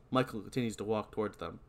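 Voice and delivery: normal talking voice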